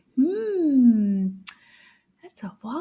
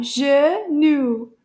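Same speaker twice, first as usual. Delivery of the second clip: "Genou" as two strong, separate beats, louder; syllable by syllable; 5 dB louder than usual. Shown as a demonstration. Teacher confirming two syllables to class, strong counted beats. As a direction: punch out both syllables of "Genou". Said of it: "deux syllabes".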